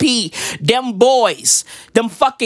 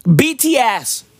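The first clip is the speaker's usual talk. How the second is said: ordinary talk, nothing marked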